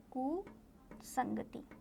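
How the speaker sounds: कुसंगति very slow and soft, split after कु; hushed कु, long pause, then संगति; slow dictation. Shown as a demonstration. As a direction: dictate कुसंगति quietly, part by part. Word repeated slowly for writing.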